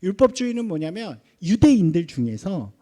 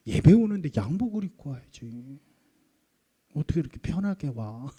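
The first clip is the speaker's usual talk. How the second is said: understated fault-finding; nagging; 4 semitones lower than usual. Acted. Longtime churchgoer on a newcomer's casual clothes, mildly nagging. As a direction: pick at someone like a fussy church elder. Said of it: "막 그렇게 잔소리를 하는 거예요".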